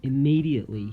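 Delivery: ordinary delivery, nothing marked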